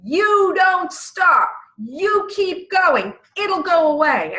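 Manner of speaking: high, loud, slow mock yell; retold scream. Stranger screams at the crying runner, loud mock yell. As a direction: bark the order to keep running. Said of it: "she started screaming at me".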